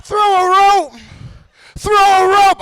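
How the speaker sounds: high call at full voice; echoing call. Acted. Trapped voice asks for help, echo now close. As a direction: send it out high and strong, repeating. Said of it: "First pussy echo".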